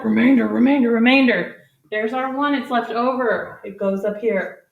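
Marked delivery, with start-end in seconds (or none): 0.0-1.6 lightly voiced barking dog chanting words. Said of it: "The dog is barking".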